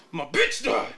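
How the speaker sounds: high, loud, laughing strained voice; hammed-up manic stranger cracking up; mock crazy client. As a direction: squeal it like the unhinged guy through laughter.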